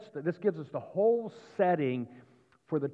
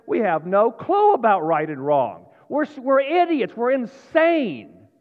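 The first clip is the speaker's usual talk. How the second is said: loud, forceful sermon climax; impassioned preacher raising the voice; fired-up preaching; 4 semitones higher, 7 dB louder than usual. Real, not acted. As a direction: hammer each charge home from the pulpit.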